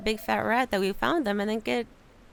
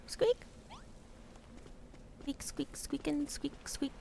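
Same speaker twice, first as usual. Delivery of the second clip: higher, soft squeaks in a row; rat squeaks; 4 semitones higher, 10 dB quieter, slower than usual. Acted. Rats chattering at the visitor, squeaking.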